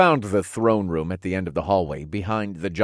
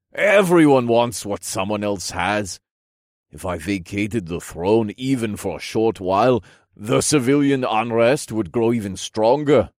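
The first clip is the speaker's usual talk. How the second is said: stately royal voice building louder; regal king, troubled; 4 dB louder than usual. Acted. King lamenting squabbling nobles, troubled regal voice. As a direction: explain gravely, swelling with exasperation.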